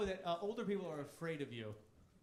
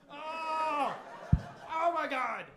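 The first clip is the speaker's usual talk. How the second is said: loud, higher cry of dismay; overwhelmed realization; exclaiming; 8 semitones higher, 9 dB louder, much slower than usual. Real, not acted. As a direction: burst out in mock anguish. Regret over missed chances.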